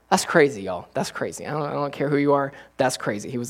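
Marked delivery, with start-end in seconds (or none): none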